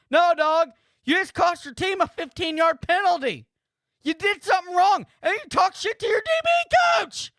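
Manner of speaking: rising, exasperated lecture at the screen; heated scolding. Real, not acted. Streamer scolding the player who mouthed off, heated. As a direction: tear into him like his coach.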